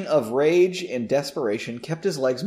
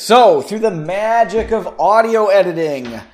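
grand announcer voice; 6 semitones higher, 9 dB louder than usual. Pretend. Mock grand magic announcement.

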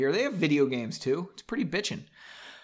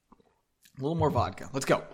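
ordinary delivery, nothing marked

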